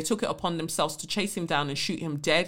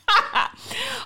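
high-pitched burst of laughter; real, gleeful high laugh; delighted laugh. Real, not acted. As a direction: crack up at a good insult. Laughing at the insult.

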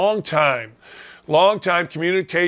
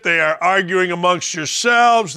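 ordinary delivery

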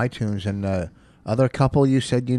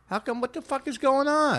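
pitched-up annoyed disbelief; high frustrated outburst; 4 dB quieter than usual. Real, not acted. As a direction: sound fed up and baffled. Phone speaker failing, frustrated.

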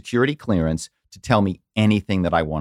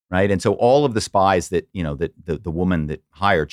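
ordinary talk

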